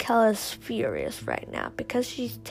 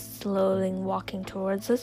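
usual speaking voice

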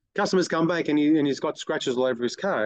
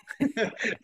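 mocking laugh; 8 semitones higher, 5 dB quieter than usual. Mocking laugh after imitation.

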